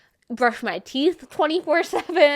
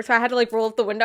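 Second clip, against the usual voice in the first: usual speaking voice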